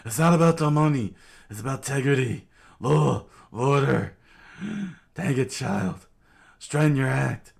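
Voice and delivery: exasperated constable shouting; outraged lawman. Acted. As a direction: bellow about law and order. Genzo loses his temper.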